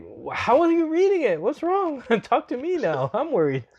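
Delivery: hammed-up high-pitched fretting; mock worried. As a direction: pitch it way up, like panicked pleading in a retold call. Retold worried phone exchange, hammed up.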